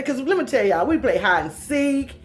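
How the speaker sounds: ordinary talk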